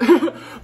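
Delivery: high, loud burst of laughter. Real, not acted. Vlogger laughing that viewers missed the sale, loud laugh.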